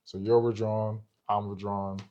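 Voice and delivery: normal talking voice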